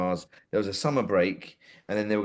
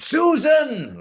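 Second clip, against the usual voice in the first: much higher, much louder Doctor Who bellow; demonstrated Susan cry, far higher and louder; William Hartnell shout; 9 dB louder than usual. Shown as a demonstration. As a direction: belt out Susan in a high, loud Hartnell voice. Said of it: "Like this, okay?".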